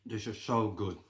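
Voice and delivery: talking while chewing; words round a bite of food; mouth full. Real, not acted. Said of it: "talking with my mouth full".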